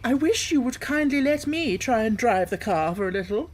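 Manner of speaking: put-on high, eager pleading old-woman voice; Toad as washerwoman. Toad disguised begging the driver to drive, high pleading.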